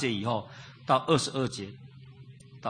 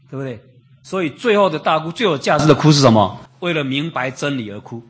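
loud pulpit preaching about John's weeping; preaching at full force; 3 semitones higher, 8 dB louder than usual. Acted. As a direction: build to a loud preaching climax. Preaching climax on John weeping.

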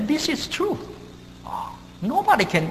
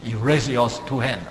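ordinary talk

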